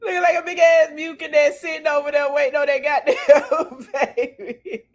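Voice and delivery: real laughter bubbling through the words, fast; laughing. Real, not acted. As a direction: talk through the giggles. Still laughing at same joke.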